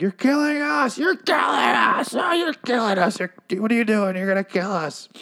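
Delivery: high, strained mock shout; hammed-up desperate pleading at very high pitch; imitated frantic yelling. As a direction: yell like a TV hero losing it. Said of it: "Rick starts yelling at the camera".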